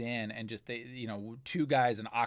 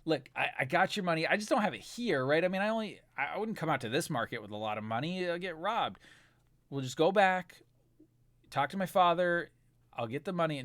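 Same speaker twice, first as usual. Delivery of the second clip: higher, quick pleading patter; sweet talk; 5 semitones higher than usual. Acted. Jonathan sweet-talking the thugs for time, higher and pleading. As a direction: talk your way out, nervous and smooth. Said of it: "kinda sweet talk him".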